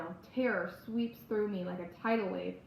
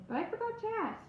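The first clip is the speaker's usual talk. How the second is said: mom voice, curious; 9 semitones higher than usual. Acted. Mother asking daughter.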